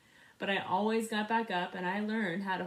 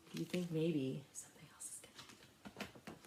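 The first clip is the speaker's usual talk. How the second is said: whisper; 5 semitones lower, 9 dB quieter than usual. Real, not acted. Teller asks listeners in suspense.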